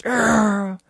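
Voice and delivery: quick mock Wookiee noise; Chewie growl. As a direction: imitate Chewbacca in passing. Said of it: "And it just goes".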